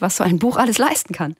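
ordinary delivery

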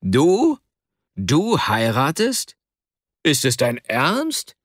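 stunned questions, a high burst then drawn-out words; frenzied outcry. A narrator's reading. Friend reacts to the marriage news, frenzied disbelief. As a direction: cry out in disbelief, stretching the words. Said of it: "schrie Akasha wie besessen".

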